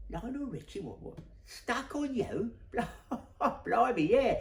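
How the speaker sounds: high, incredulous squawk of recognition; starstruck disbelief. Retold or imitated. Stranger hearing it's Lionel Richie, high-pitched amazement. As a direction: act out being amazed, voice jumping up high. Acted amazed reaction to the name.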